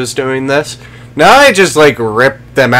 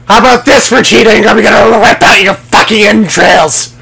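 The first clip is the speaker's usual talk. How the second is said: high, loud, shouted threat at the enemy; gleeful battle shout; 7 semitones higher, 6 dB louder than usual. Real, not acted. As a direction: yell it as you charge in. Attacking enemy in game, yelling threat.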